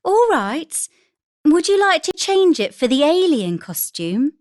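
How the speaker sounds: joking mother offering; playful mother. A narrator's reading. Mother voiced in story.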